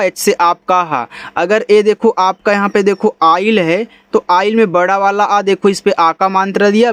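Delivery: usual speaking voice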